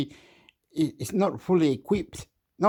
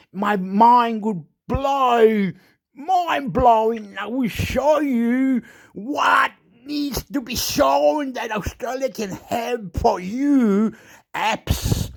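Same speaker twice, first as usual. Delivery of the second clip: higher, slow, drawn-out declaration; hyped-up boast; 6 semitones higher, slower than usual. Acted. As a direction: announce it big and slow like a showman. Hyped boast about mind blowing.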